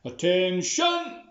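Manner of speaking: loud, slow drawn-out parade command; general shouting. Acted. General ordering soldiers to stand straight, loud slow command. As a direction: bark it like a general to his soldiers. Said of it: "Could be a general shouting".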